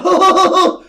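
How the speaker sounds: loud, high fake evil laugh; hammed-up cackle, way up high; mock villain cackle. Killer gloats after confessing, mock villain cackle.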